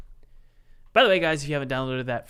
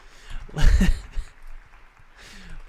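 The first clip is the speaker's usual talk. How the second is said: loud laugh; 4 semitones higher, 9 dB louder than usual. Real, not acted.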